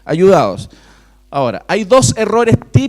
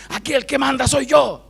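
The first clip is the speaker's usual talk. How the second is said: mock stern father; 8 semitones higher than usual. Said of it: "cuando el papá le dice".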